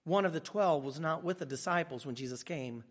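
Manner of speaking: low, measured Bible reading voice; scripture reading. Acted. Pastor reading John aloud to the church, low and measured. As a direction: read the verse softly to the congregation.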